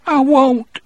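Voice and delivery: put-on high-pitched petulant child voice; childish trembling voice. A narrator's reading. Percy on the verge of tears refusing, childish voice. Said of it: "he said in a childish, trembling voice".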